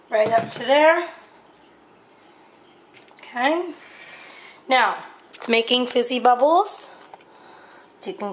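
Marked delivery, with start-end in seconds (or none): none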